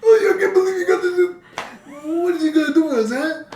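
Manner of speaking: very high, fast, laughing mom voice; shrill mock mom. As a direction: squeal it like a giddy cartoon mother.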